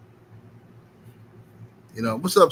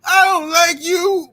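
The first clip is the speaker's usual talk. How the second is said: high, slow, loud mocking girl voice; mock schoolgirl putdown; 12 semitones higher, 10 dB louder, slower than usual. Mocking the girl who rejected him in the lunchroom, high and slow. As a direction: stretch it out high like a snotty kid. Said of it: "came over to me and said".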